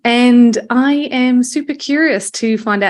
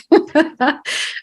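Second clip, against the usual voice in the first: laughing; 6 semitones higher than usual. Real, not acted. Laughs after her joke.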